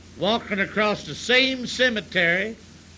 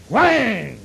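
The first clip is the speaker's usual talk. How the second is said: loud whang; 7 dB louder than usual. Acted. Piano chord imitated loudly.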